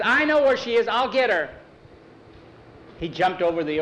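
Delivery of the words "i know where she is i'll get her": put-on eager boy. A narrator's reading. Boy answers urgently.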